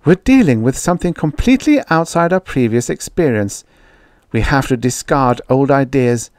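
earnest woman researcher keeping her temper; repressed anger. A narrator's reading. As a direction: hold the temper in and argue the case. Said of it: "She repressed a surge of anger".

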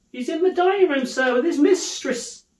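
high-pitched, helpful maid; servant girl. Acted. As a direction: pipe up politely to a visitor. Servant girl directs Scrooge.

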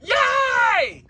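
put-on squealing high kid voices with a giggle; shrieking, laughing little kids; excited kids. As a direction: play the thrilled children cheering. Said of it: "They finna be like".

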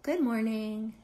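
gentle, lilting hello; friendly ladybug. Acted. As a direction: say it sweetly as the friendly bug. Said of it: "And she's saying".

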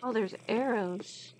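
usual speaking voice

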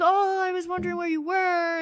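high voice for the shoe seller; imitating the shopkeeper. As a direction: lift the voice high to play him.

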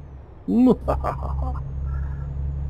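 breathy giggle, high up; high airy laugh. Real, not acted. Laughing at own French accent.

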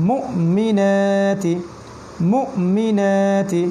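মুমিনাতি with a jolt on the hamza and slow, drawn-out vowels; push, then stretch. Shown as a demonstration. Teacher models a word for learners, pushed then stretched মুমিনাতি. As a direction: break মুমিনাতি sharply after "mu", then draw out the long vowels. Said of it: "আর এখানে আবার টানলাম কেন".

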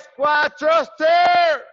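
stretched, loud callout; drawn-out coach shout. Real, not acted. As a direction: holler it across the gym. Coach calling out exercise.

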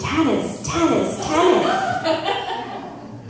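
drawn-out braying call. Retold or imitated. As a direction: bray the name like an animal. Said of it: "The internet brays".

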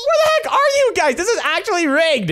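high-pitched frustrated complaint; exasperated outburst. Pretend. Seeker fed up with vanishing hiders, exasperated complaint. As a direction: protest with the voice pushed way up.

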